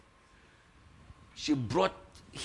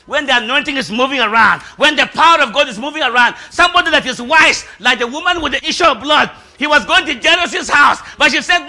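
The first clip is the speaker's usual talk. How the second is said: high, loud preacher's cry; loud, high sermon build; preaching at full force; 10 semitones higher, 12 dB louder than usual. Acted. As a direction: preach it out with fire.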